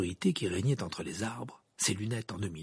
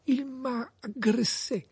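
high, slow, halting voice; dazed mumbling; much slower than usual. A narrator's reading. Krum comes to after an attack, dazed mumbling. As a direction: stammer it out slowly, still groggy. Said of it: "bredouilla Krum".

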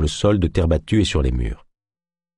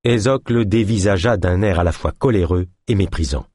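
ordinary delivery

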